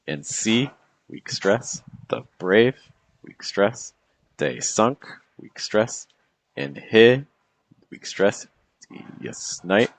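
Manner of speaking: every second syllable leaned on, as in "the BRAVE", "they SUNK"; weak-strong metre. Shown as a demonstration. Teacher scanning the next sonnet line for students, weak-strong stress. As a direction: pairs split by pauses, light "weak", lifted "stress". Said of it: "it's gonna happen in the next line".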